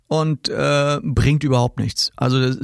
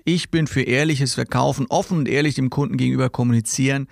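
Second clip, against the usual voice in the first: ordinary delivery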